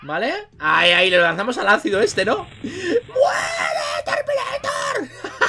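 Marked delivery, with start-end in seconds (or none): 2.6-5.4 very high, drawn-out shriek; hammed-up death cry. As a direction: scream it like a mock final blow. Mata al Terminator gritando. (chillido teatral, agudo y alargado)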